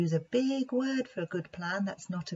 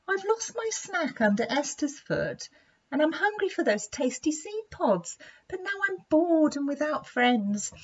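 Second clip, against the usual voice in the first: higher, small sorry voice; little Monty complaining; 8 semitones higher than usual. Acted. Monty tells the lion his woes, higher and sorry. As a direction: pour it all out like a sad little creature.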